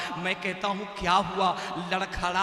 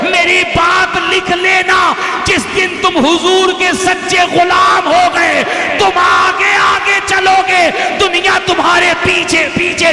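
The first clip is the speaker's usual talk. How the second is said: loud, driving oratory in parallel phrases; full-force preaching; 6 semitones higher, 16 dB louder than usual. Acted. Preacher urging Muslims to live for the Prophet, impassioned loud exhortation. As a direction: exhort the crowd, building to a high shouted climax.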